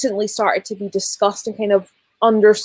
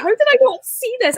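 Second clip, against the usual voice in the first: mock disbelief; 13 semitones higher than usual. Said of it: "so you're like".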